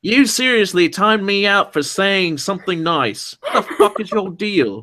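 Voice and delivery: high-pitched fake Aussie complaint; fake Aussie accent. Reading chatter's timeout complaint aloud, fake Aussie accent. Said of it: "that sounded like a fake Aussie accent".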